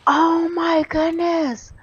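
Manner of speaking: high, slow, drawn-out shock; stunned disbelief. Real, not acted. Gamer reacts to Jimmy leaving, stunned. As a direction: stretch every word in amazement.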